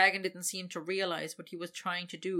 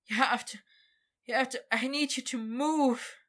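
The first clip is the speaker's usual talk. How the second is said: higher, louder urgent plea; urgent pleading; 6 semitones higher, 5 dB louder than usual. Acted. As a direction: beg him to move, urgently.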